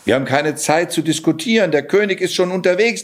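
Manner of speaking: fast, pressing cat; urging. Acted. Cat urges Johann before the king arrives, fast and pressing. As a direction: hurry him along, no time to argue. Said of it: "drängte der Kater".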